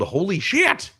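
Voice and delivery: high-pitched exclamation, no real volume; retold yell. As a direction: re-enact a yell of amazement at contained volume. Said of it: "just kind of yelled".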